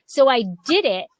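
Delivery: ordinary talk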